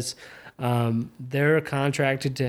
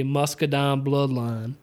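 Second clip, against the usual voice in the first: Southern accent; slower than usual. Shown as a demonstration. Podcaster showing what to avoid saying, Southern-accented "Muscadine Bloodline". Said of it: "trying to not say".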